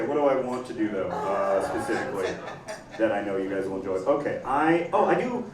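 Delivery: ordinary talk, nothing marked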